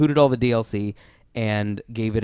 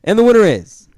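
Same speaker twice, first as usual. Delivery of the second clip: high, loud reveal call; announcer shout; 10 semitones higher, 9 dB louder than usual. Pretend. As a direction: build suspense for the winner. Award reveal, calling out.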